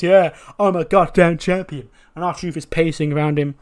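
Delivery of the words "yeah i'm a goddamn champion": louder, elated champion's boast; happy boast. Acted. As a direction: crow about your title win. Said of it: "he's happy. He goes".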